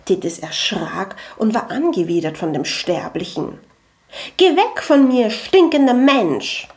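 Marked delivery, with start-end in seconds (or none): 4.4-6.7 loud, high cry of revulsion; angewiderter Ausruf. A narrator's reading. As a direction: shout them away in disgust, drawing out the end. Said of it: "rief sie". (angewidert und laut gerufen)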